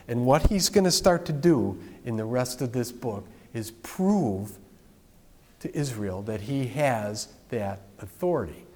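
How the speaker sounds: usual speaking voice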